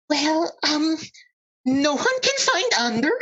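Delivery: high, hesitant confession; hammed-up nervous squeak; reluctant admission. Centaur reluctantly admits to crowd.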